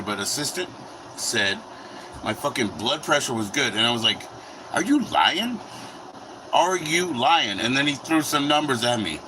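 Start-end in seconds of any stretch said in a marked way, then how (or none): 4.7-5.6 high rising disbelief, quick; are you serious; incredulous. Retold or imitated. Disbelief at good blood pressure. 6.5-7.6 slow, drawn-out doubting repeat; stretched-out skeptical repeat; slow disbelief. Retold or imitated. Repeats the question slower.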